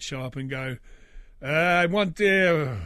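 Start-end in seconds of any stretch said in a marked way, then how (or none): 1.4-2.8 lightly put-on generic European accent, louder; a hint of a European migrant's accent. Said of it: "generic European accent".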